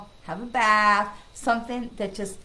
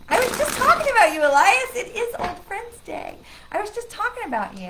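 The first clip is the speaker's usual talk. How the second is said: excited; 11 semitones higher, 7 dB louder than usual. Real, not acted. Greeting an old friend joyfully.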